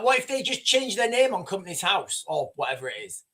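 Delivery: normal talking voice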